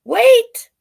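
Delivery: high, loud cry of wait; loud interrupting wait. Acted. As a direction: cut in sharply. Beetle interrupts loudly.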